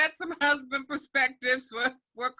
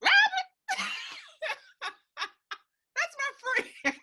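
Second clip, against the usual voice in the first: surprised laugh; 12 semitones higher than usual. Retold or imitated. Retold surprised recognition, laughing.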